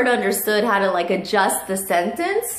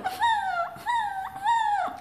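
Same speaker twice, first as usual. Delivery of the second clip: imitated dog crying; 7 dB quieter than usual. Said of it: "and Lucky's going".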